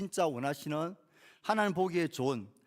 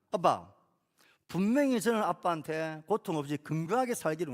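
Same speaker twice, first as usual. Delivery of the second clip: put-on raised, higher voice of an aggrieved child; angry child protesting; 4 semitones higher, 3 dB louder than usual. Child to dad after a painful injection, indignant protest. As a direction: play a hurt child indignantly confronting dad. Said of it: "울면서 화를 내면서".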